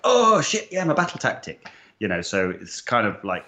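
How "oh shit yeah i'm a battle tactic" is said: high-pitched, loud "oh shit" realization; suddenly remember it in a panic. Retold or imitated. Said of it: "some people are like".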